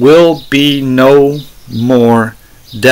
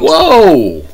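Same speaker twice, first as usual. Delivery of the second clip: high drawn-out whoa; mock astonishment; 9 semitones higher, 3 dB louder than usual. Preacher interrupting the played clip, exaggerated astonishment. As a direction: call it out high as if stunned.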